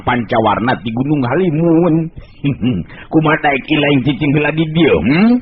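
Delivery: put-on high, cackling old man voice; a sly elder, pleased he got his way; pleased old sorcerer. Ki Buyut Ireng, pleased elder.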